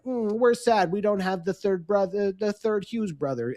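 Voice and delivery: lightly voiced New Jersey accent, mock sad; a hint of Jersey in a sulky team voice. Host voicing sulky Devils in a light Jersey accent. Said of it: "That was an amazing accent".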